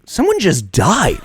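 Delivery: high, loud, drawn-out disbelief; retold protest, pushed high and slow; incredulous. Author recalling a death on the field, incredulous. Retells disbelief at game resuming.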